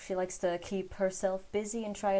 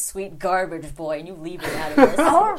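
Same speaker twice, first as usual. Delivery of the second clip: mock indignant; 10 dB louder than usual. Defending a beloved game.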